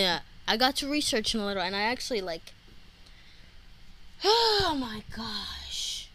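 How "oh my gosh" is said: long, slow, high sighed words; weary sigh. Real, not acted. Podcaster faced with repeating everything, long exasperated sigh. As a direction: sigh it out, drawn right down. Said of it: "like, a sigh for me to repeat".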